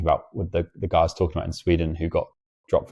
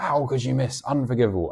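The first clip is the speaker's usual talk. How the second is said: higher mock yell of a shouty coach; retold scream at players; 7 semitones higher than usual. Coach screaming at a girl who missed a dig, mock yell. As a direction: imitate the coach's high outraged bark. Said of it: "he, like, screamed at them".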